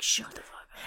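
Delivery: hissed under the breath; whispered. Retold or imitated. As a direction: whisper it in annoyance. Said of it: "I'm like".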